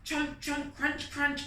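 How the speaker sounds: high, munching chomp-crunch; chomping sounds. Acted. Narrator munching along with the eating. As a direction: chew the words like a hungry fly.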